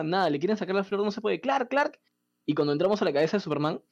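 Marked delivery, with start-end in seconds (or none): 1.4-2.0 much higher mock call of a name; high, insistent retold call. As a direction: imitate calling someone to wake up. Calling out to sleeping Clark.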